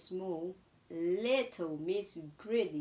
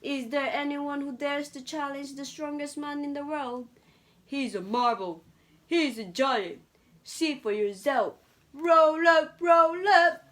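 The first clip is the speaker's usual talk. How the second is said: loud fairground barker; calling out to crowd; 5 semitones higher, 9 dB louder than usual. A narrator's reading. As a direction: drum up a crowd with a ringing shout. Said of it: "A man was calling out".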